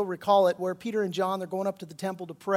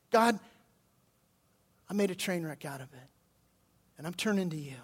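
hushed repentance; 2 semitones lower, 5 dB quieter than usual. Acted. Preacher modelling a sinner turning to God, quiet and contrite. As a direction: confess quietly, pausing between phrases.

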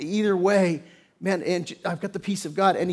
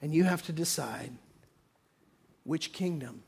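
hushed warning; 2 semitones lower, 8 dB quieter than usual. Real, not acted. Closing solemn warning, fading.

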